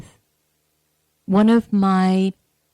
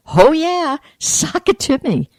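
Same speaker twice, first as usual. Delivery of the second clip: keen, almost giddy agreement; eager yes; 7 semitones higher than usual. Retold or imitated. Telling the dentist's helper yes to everything, eagerly excited. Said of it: "And I'm like".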